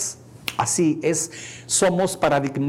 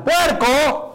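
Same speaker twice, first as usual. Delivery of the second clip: grito agudo y fuerte; grito de insulto; 11 semitones higher, 5 dB louder than usual. Retold or imitated. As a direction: gritarlo desde un coche. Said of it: "le gritan". (grita "¡Puerco!" con burla)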